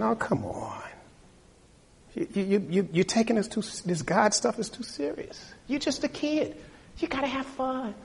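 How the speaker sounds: put-on high, soft taunting college kid; mocking peer. King as college peer mocking the kid who eats alone, high and soft. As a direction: tease the loner into joining.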